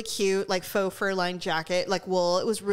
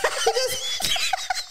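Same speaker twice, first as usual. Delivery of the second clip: laughing; 14 semitones higher than usual. Real, not acted. Cracking up mid-story.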